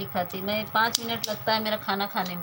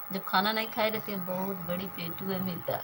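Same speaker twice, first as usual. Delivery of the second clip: ordinary talk, nothing marked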